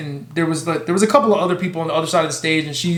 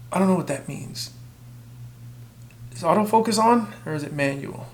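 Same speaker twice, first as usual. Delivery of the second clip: ordinary talk, nothing marked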